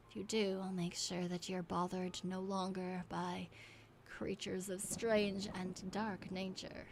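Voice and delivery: measured, subdued preaching to the faithful; solemn cult leader. Acted. Cultist urges kin to keep faith in the great old one, solemn. Reads cultist's message to followers.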